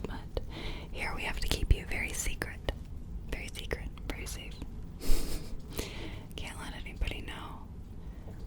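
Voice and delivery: very hushed, confiding whisper; barely audible, keeping it between the two of you; secretive whisper. Acted. Whispers about keeping the creature secret.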